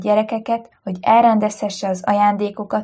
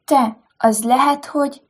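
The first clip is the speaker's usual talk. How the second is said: ordinary delivery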